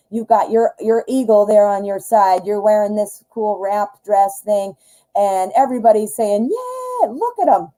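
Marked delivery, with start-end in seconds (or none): 6.5-7.7 high excited cheer; cheering crowd. Retold or imitated.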